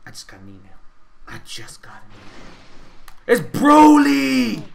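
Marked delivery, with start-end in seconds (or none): 1.3-2.6 hushed secretive whisper. Pretend. Gamer whispers to viewers as if sharing a secret. Teasing an announcement, whispered repeat. 3.3-4.6 slow, drawn-out shout, much louder and higher; dramatic shout. Pretend. Gamer reveals the boss to viewers with a slow dramatic shout.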